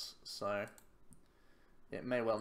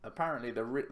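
normal talking voice

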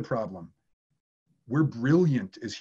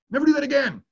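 much higher, louder hammed-up scolding; mock outraged yell; 13 semitones higher, 6 dB louder, faster than usual. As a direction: yell it as an exasperated agent. Repeats agent's warning, escalated.

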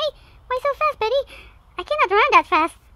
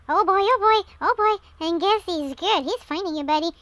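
normal talking voice

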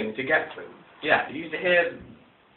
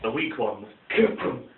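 usual speaking voice

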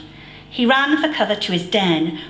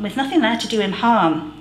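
ordinary delivery, nothing marked

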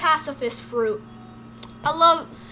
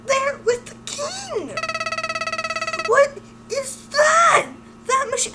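shocked; 10 semitones higher, 4 dB quieter, slower than usual. Acted. Character shocked by machine.

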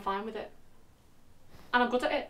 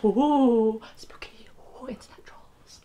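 mock spooky whisper; slower than usual.

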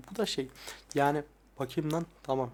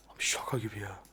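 soft spoken; 6 semitones lower, 6 dB quieter than usual. Real, not acted. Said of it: "benim ses tonu değişmek zorunda kalıyor".

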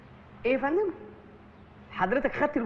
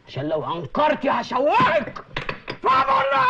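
menacing threat building to a shout; 2 semitones higher, 5 dB louder than usual. Acted. Interrogator threatens woman.

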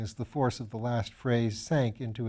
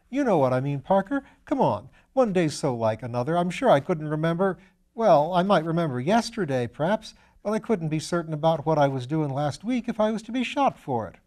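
put-on higher, bright-eyed young medical student voice; eager young student; 5 semitones higher, 5 dB louder than usual. Young Piggott protesting to Lord Peter, higher eager student voice. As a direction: play the eager, slightly foolish young student. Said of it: "young Piggott, instantly embarrassed".

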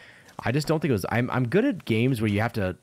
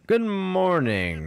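high, slow, drawn-out greeting; exaggerated greeting; 11 semitones higher, 4 dB louder, much slower than usual. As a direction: stretch it out in a playful high lilt. Answers game character's greeting.

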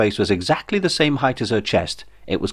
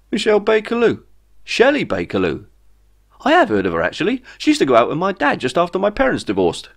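surprised recognition; 10 semitones higher than usual. A narrator's reading. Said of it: "he smiled".

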